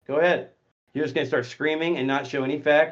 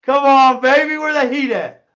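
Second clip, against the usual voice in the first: taunting call-out; 9 semitones higher, 9 dB louder, slower than usual. Real, not acted. Taunting muted troll.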